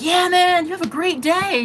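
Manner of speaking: upbeat acted goodbye; imitated friendly send-off. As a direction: send him off cheerfully, imitating a friendly guy. Imitating his cheerful send-off.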